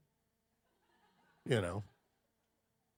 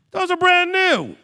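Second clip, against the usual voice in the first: shrill mom scold; 9 dB louder, slower than usual. Retold or imitated. Mother scolding about jeans.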